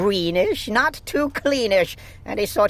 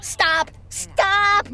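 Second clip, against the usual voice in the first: high repeated scream; desperate cry to stop him; 10 semitones higher than usual. A narrator's reading. Little sister screaming at the glunk to stop. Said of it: "my little sister screamed".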